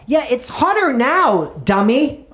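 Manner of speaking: high, slow, drawn-out comeback; exaggerated high-pitched jeer; mock retort. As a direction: taunt someone for stating the obvious. Acted retort to "Wow, it's hot.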